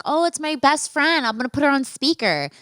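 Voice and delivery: high, put-on chirpy phone pickup; feigned innocent announcement. Telling the date her friend is calling, falsely chirpy. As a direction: act innocently delighted, pitched well up.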